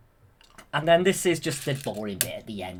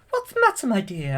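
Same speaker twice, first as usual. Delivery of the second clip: put-on high, kindly old fairy; 7 semitones higher, 6 dB louder than usual. Flora asks upset Fauna what's wrong, high. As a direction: ask it like a fussing godmother. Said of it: "Flora's like".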